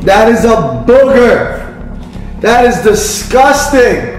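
grossed out, slow and high; disgusted. Real, not acted. Dad chewing booger jelly bean.

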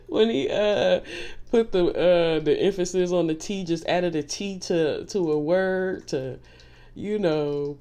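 words squeezed out between laughs; laughing while talking. Real, not acted. Reader giggling as she recalls a comedy bit.

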